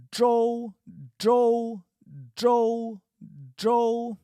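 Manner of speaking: zhou loud and high, with slow spacing; zhou quiz. Shown as a demonstration. Teacher quizzing students with zhou, loud and high. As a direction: call out zhou clearly. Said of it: "So I'll say one".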